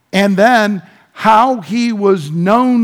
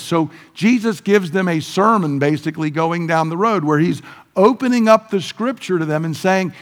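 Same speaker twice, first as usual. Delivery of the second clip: ordinary talk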